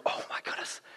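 hushed, voiceless astonishment; awed whisper. Retold or imitated. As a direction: whisper it as if stunned by who you see. Mary recognises Jesus, stunned.

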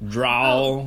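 Drawl, showing the southern sound; teasing demo. Shown as a demonstration. Mocking her friend's southern pronunciation, teasing. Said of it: "that's some Indiana boy talk".

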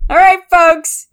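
loud slow call from the bathroom; from another room. Pretend. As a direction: call out from behind a door. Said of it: "I yell out of the, the bathroom".

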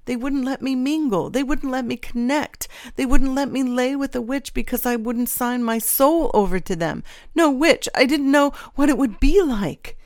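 high, distressed self-justifying; wretched, high-pitched complaint; anguished pleading. A narrator's reading. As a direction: plead your case as you fall apart. Traitor justifies himself, falling apart.

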